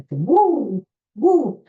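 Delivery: loud, high wrestler whoop; Ric Flair woo. Retold or imitated. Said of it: "he'd go".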